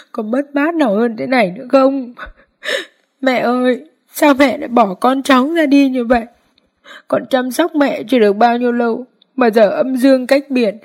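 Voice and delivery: high, strained mourning lament, breaking into sobs; wailing in grief. A narrator's reading. Daughter-in-law clinging to the coffin, wailing high with sobs. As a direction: cry out over the coffin, voice pitched high. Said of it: "khóc lớn".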